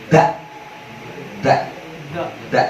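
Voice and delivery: Da with the tongue tip behind the upper teeth, thin; light tongue-tip da. Shown as a demonstration. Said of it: "Taruh ujung lidahnya di belakang gigi atas".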